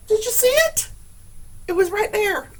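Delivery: high, loud, still panicked; breathless from the fright; rattled. Real, not acted.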